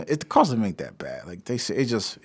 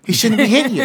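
much higher, louder mock old-man voice; older client voice; 10 dB louder than usual. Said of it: "He's like".

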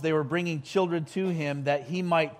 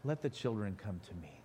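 put-on "Let the children come to me" said much softer, lower and gentle; soft, gentle version; 7 semitones lower, 12 dB quieter than usual. Shown as a demonstration. Pastor showing the gentle reading of Jesus' words, said softly. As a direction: whisper it tenderly, the soft way people imagine it. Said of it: "doesn't that sound like a soft thing".

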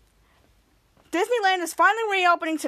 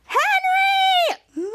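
a long, much higher, drawn-out angry cry; furious yell; 3 dB louder than usual. Retold or imitated. Piper to Henry after the hat breaks, a high drawn-out angry yell. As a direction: wail his name in fury at the broken hat. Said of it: "Piper got so mad".

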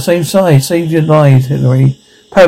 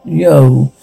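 ordinary talk, nothing marked

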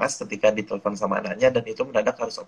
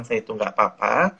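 lower, composed reassurance; calm response; 4 semitones lower than usual. Acted. Grown child reassuring anxious parents at home, calm. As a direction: answer calmly to settle their worry. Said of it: "Kalau kita sudah menanggapinya tenang".

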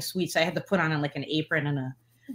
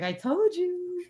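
slow, higher knowing tone; smug told-you-so; 12 semitones higher, much slower than usual. Retold or imitated. Imitating her friend, slow and higher. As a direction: play the friend who called it. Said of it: "Lynn was like".

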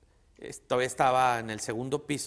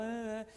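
imitated sobs; 10 semitones higher, 4 dB quieter than usual. Mom sobbing on the phone.

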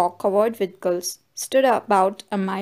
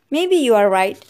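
plain reading voice

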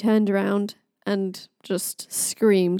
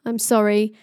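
ordinary delivery

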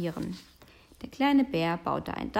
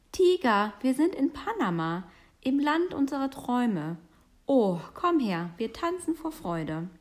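higher, excited announcement; overjoyed; 5 semitones higher, 3 dB louder than usual. Acted. Bear tells tiger they are in the land of their dreams, overjoyed. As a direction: burst out with joy at the discovery. Joy at reaching Panama.

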